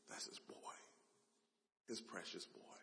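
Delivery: whispered, soft and fond; tender whisper. Acted. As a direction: breathe it like a proud father. Father watching son, tender aside.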